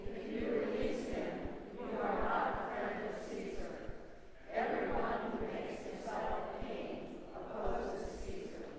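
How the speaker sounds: soft, breathy stage whisper; whispered warning from a mob; crowd cry, hushed. A narrator's reading.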